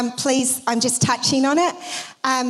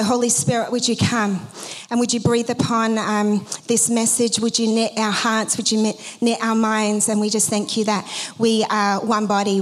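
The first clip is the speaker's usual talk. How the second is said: lower, earnest prayer voice; 4 semitones lower than usual. Acted. Pastor praying over congregation before message, earnest prayer. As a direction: pray it over the church, steady and reverent.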